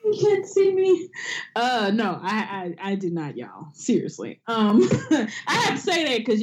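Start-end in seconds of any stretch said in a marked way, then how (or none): 0.0-1.1 high, loud catchphrase shout; catchphrase callout. Retold or imitated. Echoing co-host's catchphrase, high and loud. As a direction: belt out the catchphrase.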